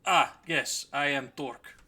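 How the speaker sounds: loud orc self-introduction; booming character hello; Torque the orc. Acted. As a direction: announce yourself grandly in an orc voice. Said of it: "he looks to the rest".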